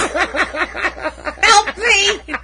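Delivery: high-pitched cry through giggles; helplessly cracking up, beg for rescue; laughing mock plea. Punster begging co-host for help, laughing mock plea.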